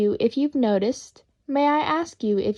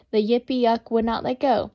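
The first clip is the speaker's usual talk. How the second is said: usual speaking voice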